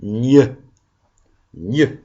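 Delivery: "Gne" said slowly with clear mouth shapes; gne sound. Shown as a demonstration. Teacher showing the class how to say "Gne", exaggerated mouth. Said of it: "la bouche s'ouvre".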